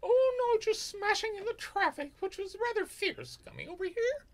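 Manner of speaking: posh voice. Acted. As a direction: answer like a grand English gent. Continues posh bit.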